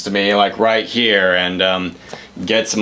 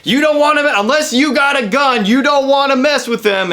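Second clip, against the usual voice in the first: much higher, put-on voice of the mustached busybody; mock khaki shorts guy; 12 semitones higher than usual. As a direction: lampoon the bar guy warning Kyle off. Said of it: "is coming like".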